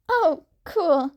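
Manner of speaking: put-on high, earnest teen voice; Izuku, shy and friendly. A narrator's reading. Izuku explains himself to the stranger, high and earnest. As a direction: keep it light and eager.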